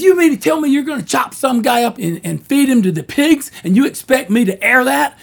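can't believe what he heard; incredulous client. Retold or imitated.

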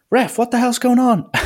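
high-pitched incredulous yell, laughing; mock protest. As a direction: imitate baffled players calling up to the ref. Players protest to referee.

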